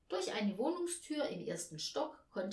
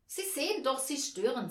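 higher, louder agitated protest; a touch of nerves, voice raised; nervous; 6 semitones higher, 5 dB louder than usual. A narrator's reading. Hostess to an intruding stranger, nervously agitated. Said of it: "sagte die Frau nervös".

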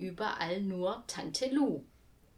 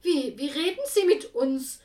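stammering, louder outraged blurt; blurted shock; 7 semitones higher, 8 dB louder than usual. A narrator's reading. Jutta Mecke reacts to Tante Lu, shocked stammering blurt. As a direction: spit it out, caught off guard. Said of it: "stieß Jutta Mecke hervor".

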